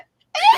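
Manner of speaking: very high squeak like a squeaky toy; squeaky toy cat noise. Retold or imitated. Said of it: "she's like a squeaky toy".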